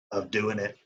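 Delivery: normal talking voice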